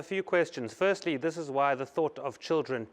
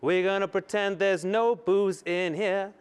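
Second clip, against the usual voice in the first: higher, sing-out delivery; theatrical, raised-pitch proclamation; hammed-up showy announcement; 6 semitones higher, 3 dB louder than usual. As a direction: play it up like a preacher's revival line. Playful performed drink announcement after restart.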